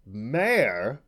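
loud, high indignant cry; shrill trumpeting. A narrator's reading. Unicorn affronted at being called a mare, shrilly. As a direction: trumpet it in outrage. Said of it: "trumpeted the words so shrilly".

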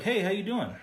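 hammed-up friendly stranger saying hey; friendly stranger greeting. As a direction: call out a bright friendly greeting. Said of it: "he's just like".

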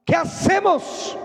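a booming summons across heaven, then a worried question; God calling a meeting. Acted.

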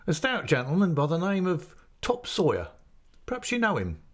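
put-on a friendly innkeeper's man coaxing the boy; chatty waiter. A narrator's reading. Waiter at the inn teasing the boy about the ale, lightly voiced. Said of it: "he said".

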